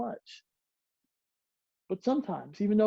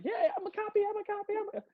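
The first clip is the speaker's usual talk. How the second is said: high squeaky mock voice; sing-song high mock copy voice; mock copycat; 14 semitones higher, 3 dB quieter, faster than usual. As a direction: play a silly copycat, pitched way up. Mocking people who copy others.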